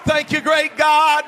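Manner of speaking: slightly eased, still-shouted echo; settling shout of praise. Acted. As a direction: let the second "great God" come down a notch. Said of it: "repeats the line twice".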